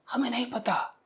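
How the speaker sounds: breathy whisper, dropping the voice right down; a hushed aside to the class. Real, not acted. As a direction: whisper it as if admitting doubt. Teacher aside about not knowing.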